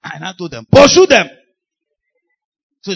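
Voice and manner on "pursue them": sudden loud, high order; shouted command. Retold or imitated. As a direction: bark it out to the team as an order. Retells commanding helpers to chase.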